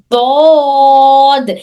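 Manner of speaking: slow, held دود with the tongue sides on the molars; drawn-out Arabic dhad. Shown as a demonstration. Teacher modelling the letter for her class, drawn out very slowly. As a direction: stretch دود out long as a tajweed demo. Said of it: "لیکن انہیں ہمارے منہ میں تھوڑا پھیلنے".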